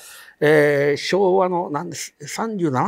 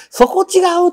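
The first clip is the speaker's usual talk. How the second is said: sharp objection; 7 dB louder than usual. Retold or imitated. Friend objects, wrong bridge.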